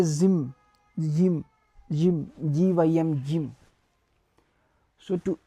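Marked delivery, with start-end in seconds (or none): 0.0-0.5 zim said with a French zh start; French gym. Shown as a demonstration. Teacher modelling for students, French gym. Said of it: "we will say zim".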